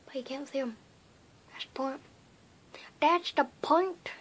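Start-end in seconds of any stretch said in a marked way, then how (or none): 3.0-4.1 drawn-out, higher weird voice; playful odd voice, slow and lifted. Pretend. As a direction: stretch it out in a silly rising voice. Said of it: "weird voices just 'cause I want to".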